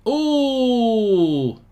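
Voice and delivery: loud high gasp of surprise; sharp ooh. Real, not acted. Commentator to stream, loud surprised ooh. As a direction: gasp as the keeper nearly saves it. Penalty nearly saved.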